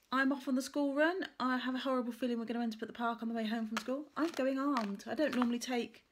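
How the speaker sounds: ordinary talk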